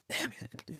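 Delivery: breathy whispered repeat. Real, not acted. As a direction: whisper it to yourself, grieving. Grieving repeat, whispered to himself.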